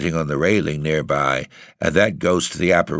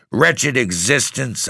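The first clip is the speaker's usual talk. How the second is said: high, slow, bitter outcry; fierce cry; 6 semitones higher, slower than usual. Acted. Narrator turns fiercely on the ghost, crying out bitterly. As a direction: spit the words at the ghost. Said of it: "I cried".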